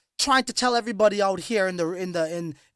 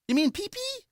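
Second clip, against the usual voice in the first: mock confused normie; 10 semitones higher, 5 dB quieter than usual. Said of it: "Yo, you mean Pokemon?".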